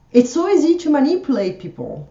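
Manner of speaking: normal talking voice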